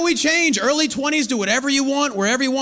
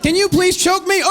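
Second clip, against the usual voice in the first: mock request; 6 semitones higher, 4 dB louder than usual. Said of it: "You know, like".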